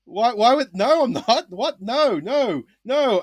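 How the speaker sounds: high, loud flustered denial; retold panic. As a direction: protest in shock. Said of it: "And I'm like".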